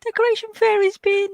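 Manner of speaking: high, loud, gushing mock delight; delighted exclamation. Coworkers arriving to find the decorations, gushing high and loud. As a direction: squeal it like a thrilled coworker. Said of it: "everyone used to go".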